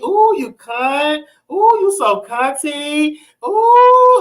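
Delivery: slow, high, drawn-out cooing. Shown as a demonstration. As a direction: stretch each exclamation high, showing how they talk. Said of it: "That's how they talk".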